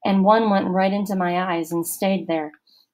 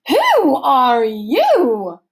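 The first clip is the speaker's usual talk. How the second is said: slow, loud, higher stern matron; stern camp counselor; 6 semitones higher, 8 dB louder, much slower than usual. A narrator's reading. Missus Periwinkle interrogating Allison, stern, slow and loud. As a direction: lecture slowly like a suspicious matron. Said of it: "Missus Periwinkle demanded".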